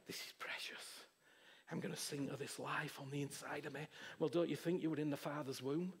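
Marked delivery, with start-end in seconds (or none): 0.1-3.9 soft breathy whisper to a newborn; hushed tender whisper; cooing whisper. Retold or imitated. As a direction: whisper it like a mother over her baby. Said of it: "she's going".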